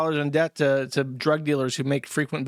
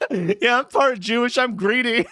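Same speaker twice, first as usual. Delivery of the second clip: higher-pitched, repeated through laughter; laughing quote; 6 semitones higher, 3 dB louder than usual. Retold or imitated. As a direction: crack up while quoting the joke. Laughing repeat of the clip's joke.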